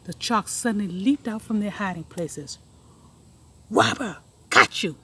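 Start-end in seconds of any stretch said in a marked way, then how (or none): 3.7-5.0 loud triumphant cry; shout. Acted. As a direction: catch him out. Chalks leap out.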